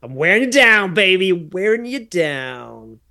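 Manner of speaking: high, loud opening, drawn-out ending; imitated sitcom nerd taunt; teasing catchphrase. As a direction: crow it playfully, stretching the last words. Acts Steve's teasing catchphrase at Laura.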